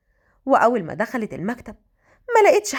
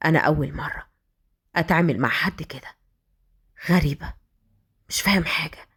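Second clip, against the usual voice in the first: confused whisper; 7 semitones lower than usual. A narrator's reading. Fahd muttering confused to himself.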